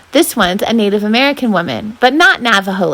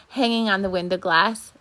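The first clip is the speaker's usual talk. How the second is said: ordinary delivery, nothing marked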